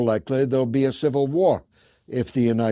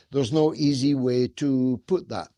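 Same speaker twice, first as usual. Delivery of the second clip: ordinary delivery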